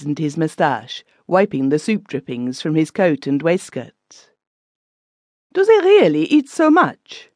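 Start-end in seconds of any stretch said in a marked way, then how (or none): 5.5-7.3 put-on high, loud, amazed young German lady; Fräulein Stiegelauer. The Fräulein asks the guests about English breakfasts, high and incredulous. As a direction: squeal it up high in disbelief. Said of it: "asked Fräulein Stiegelauer".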